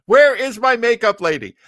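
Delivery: usual speaking voice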